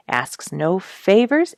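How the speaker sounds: ordinary delivery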